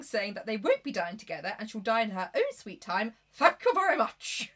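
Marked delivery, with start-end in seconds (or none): 3.3-4.5 louder, higher, slow sarcastic thanks. Retold or imitated. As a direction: snub him with mock politeness. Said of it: "slagging off the song".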